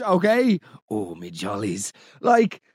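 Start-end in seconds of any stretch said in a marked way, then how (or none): 0.9-1.9 "Oh, me jollies" dropped very low and soft, slow; mocking "me jollies". Host mocking the caller's "me jollies" in a low, slow, dirty voice. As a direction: drool "me jollies" slowly in a low, lecherous croak. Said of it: "is utterly depraved".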